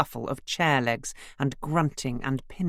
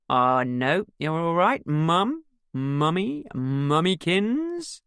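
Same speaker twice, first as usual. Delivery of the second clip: teasing; 3 semitones higher, 4 dB louder, slower than usual. A narrator's reading. Son teasing mother drunkenly.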